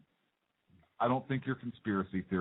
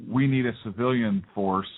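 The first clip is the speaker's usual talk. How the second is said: deliberate quoting; 4 semitones lower than usual. Retold or imitated. Host quoting the president to make a point, deliberate.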